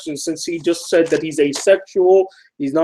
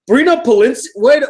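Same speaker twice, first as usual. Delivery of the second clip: high, louder double-take on the name; surprised; 7 semitones higher, 5 dB louder than usual. Real, not acted. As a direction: suddenly catch the name. Realizes the name he knows.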